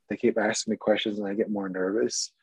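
usual speaking voice

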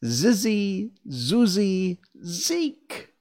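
high-pitched incantation; reciting magic charm. A narrator's reading. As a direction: chant the nonsense words one by one. Dorothy finishes the magic charm.

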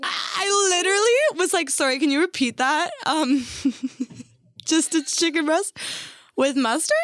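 high, laughing disbelief; incredulous, amused. Retold or imitated. Waitress checking the magician's odd order, high-pitched and incredulous.

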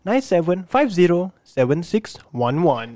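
slow, careful number dictation; slowly. Shown as a demonstration. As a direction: spell out each digit slowly. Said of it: "I'll say it one more time slow".

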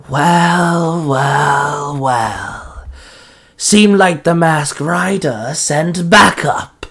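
drawn-out, taunting monster voice; chilling cackling villain. Acted. Icy villain greets the riders sent as backup, chilling cackle. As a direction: slowly relish it like an icy villain. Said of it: "a chilling voice cackled".

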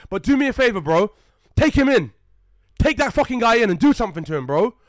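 loud, high angry pleading with cops; furious man demanding police act; heated demand. Retold or imitated. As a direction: insist hard, furious. Demanding police arrest accuser.

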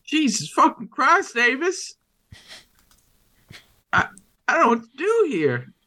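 high panicked outburst after a near miss; panicked swearing. Acted. Father shot at through the door, panicked outburst.

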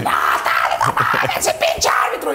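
fan yelling. Acted. Fan to referee from the stands, acted shouting. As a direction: yell at the referee like an outraged fan. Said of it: "estamos gritando".

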